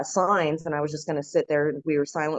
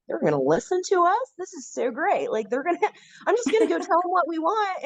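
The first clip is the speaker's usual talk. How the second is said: naive eagerness; 13 semitones higher than usual. Retold or imitated. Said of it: "I'm like".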